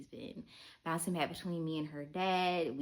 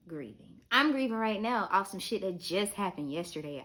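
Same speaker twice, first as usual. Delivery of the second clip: ordinary delivery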